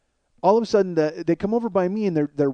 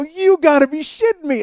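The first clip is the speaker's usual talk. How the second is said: high, loud incredulous outburst; outraged disbelief; 11 semitones higher, 7 dB louder than usual. Retold or imitated. Guard hearing a bounty put on him, outraged disbelief. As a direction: blurt it out, stung and incredulous.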